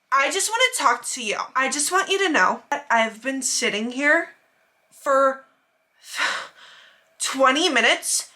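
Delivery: slow, drawn-out, put-upon complaint; super rude customer. Retold or imitated. Customer complaining to the manager, slow and rude. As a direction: imitate condescension with heavy pauses and a sigh. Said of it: "Just being super rude to my manager".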